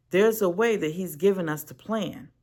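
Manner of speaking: ordinary delivery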